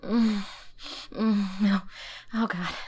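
moaning loudly with pleasure; loud pleasured moans. Acted. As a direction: let the moans swell.

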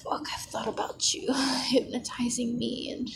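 breathy ASMR whisper, close to the mic; intimate whisper. Acted. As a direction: whisper it like a secret in his ear.

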